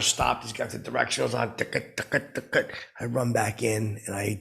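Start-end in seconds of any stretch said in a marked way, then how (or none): 1.6-2.7 unvoiced tick-a tick-a of a blinker; whispered clicking blinker imitation; turn signal ticking. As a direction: click it out like an indicator.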